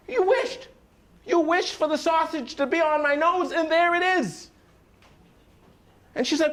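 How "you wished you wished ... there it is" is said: indignant husband. Acted. Husband complaining, sausage on nose.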